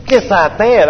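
high-pitched mocking parroting. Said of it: "I was mocking him back".

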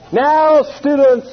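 hammed-up old preacher calling out; loud mock preacher summoning the class; imitating the preacher. Preacher voices Oral again.

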